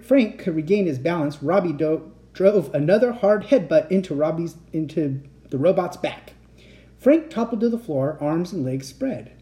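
usual speaking voice

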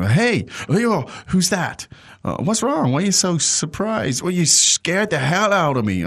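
high, hammed-up startled English lines; teacher acting out a startled friend, hammed up and high; dramatic acted dialogue. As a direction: play it big and shocked, like a soap opera. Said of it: "Kind of dramatic, wasn't it?".

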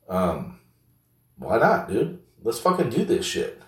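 ordinary delivery

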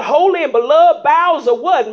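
ordinary talk, nothing marked

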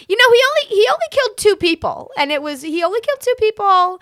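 high, loud fond mom defending Ed. Retold or imitated. Mom insisting to her kid that Ed only killed two. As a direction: plead his case like a doting mom. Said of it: "She'll say shit like".

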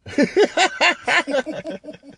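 big burst of laughter; loud laugh. Real, not acted. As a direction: cackle at your own joke. Laughs at his own joke.

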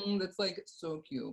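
reluctant, high-pitched niceness; awkward, insincere politeness. Acted. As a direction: hedge it so you don't hurt their feelings. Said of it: "really doesn't wanna say what she's thinking".

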